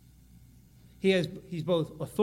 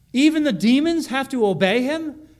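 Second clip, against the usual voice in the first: higher and louder, full of wonder; amazed question; 7 semitones higher, 7 dB louder than usual. Acted. Preacher voicing the crowd's disbelief, higher and louder. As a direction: sell the astonishment of the crowd.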